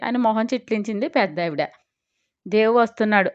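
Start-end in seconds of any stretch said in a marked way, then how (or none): none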